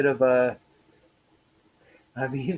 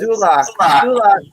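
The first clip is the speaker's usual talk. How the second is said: calling out; 6 semitones higher, 9 dB louder than usual. Real, not acted. Calling out a name.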